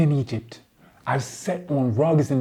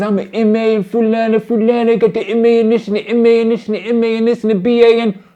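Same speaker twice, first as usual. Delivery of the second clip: higher-pitched mock boasting; fast, higher, dismissive list; mocking title-dropping; 5 semitones higher, 5 dB louder than usual. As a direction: rattle off the credentials dismissively. Mocks listing of academic titles.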